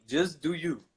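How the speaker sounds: ordinary delivery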